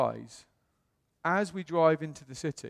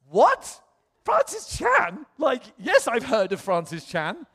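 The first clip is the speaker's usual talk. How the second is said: excited disbelief; 8 semitones higher, 7 dB louder, slower than usual. Retold or imitated. Retold excited reaction to email.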